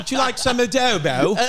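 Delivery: high, hammed-up British accent on a Puerto Rican phrase; mock British accent. Joking to friends about meeting a British Puerto Rican, in a mock British accent. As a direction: camp it up like a posh Brit offering food. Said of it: "first Puerto Rican with a British accent".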